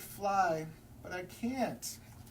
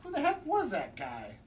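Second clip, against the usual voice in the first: higher, louder bewildered grumble; puzzled and annoyed; bewildered penguin; 6 semitones higher, 4 dB louder than usual. Acted. Penguin baffled by the walrus, grumbling.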